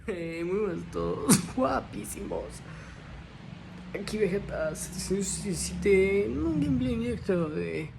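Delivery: loud hyped streamer greeting; youtuber intro voice. Pretend. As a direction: announce it like a gaming channel host. Mock gamer intro voice.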